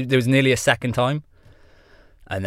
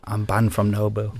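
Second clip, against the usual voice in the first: put-on Sven accent at his usual pitch. Guest stays in character as his alter ego, put-on accent. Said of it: "putting on the fucking accent".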